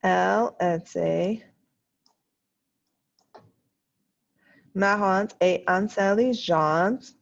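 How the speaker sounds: "Elle était marrante et intelligente" spoken slowly, word by word; slow dictation. Shown as a demonstration. Teacher dictating to the class while typing, slow and deliberate. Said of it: "so I can type more easily".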